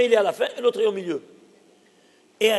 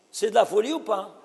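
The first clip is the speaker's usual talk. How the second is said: ordinary talk, nothing marked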